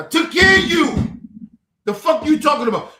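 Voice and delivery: high, loud, indignant outburst; angry shout. Real, not acted. Reminding his sister he cared for her, shouting angrily. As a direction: throw it in their face, shouting. Confronting sister who disowns him.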